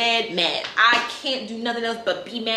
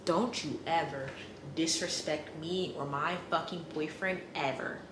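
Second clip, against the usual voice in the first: quiet warning; 5 semitones lower, 11 dB quieter than usual. Real, not acted. Quiet threat to the man.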